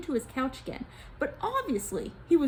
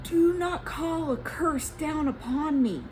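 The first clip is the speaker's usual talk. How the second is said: begging; 5 semitones higher, slower than usual. Acted. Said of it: "he begged".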